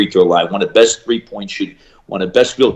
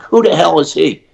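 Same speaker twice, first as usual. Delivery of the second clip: surprised; 6 semitones higher, 5 dB louder than usual. Retold or imitated. Students correct him, he's baffled.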